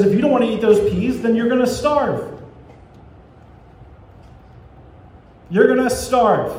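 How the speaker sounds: a fed-up parent laying down the law; stern parent. Retold or imitated. Parent at dinner table scolding child, mock angry. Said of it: "God slams his hand on the table".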